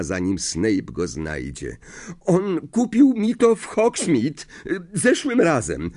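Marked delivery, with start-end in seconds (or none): 2.2-5.9 high, hesitant pupil's voice; nervous excuse. A narrator's reading. Pupil covering up before a suspicious professor, nervous. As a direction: stumble through a hasty excuse.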